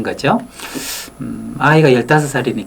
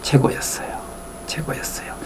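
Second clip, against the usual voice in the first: 숨소리로 최고였어요; 감탄을 숨죽여 속삭이듯; 속삭이는 감탄; 10 dB quieter than usual. Real, not acted. 감탄하며 속삭임. (숨죽인 감탄의 속삭임)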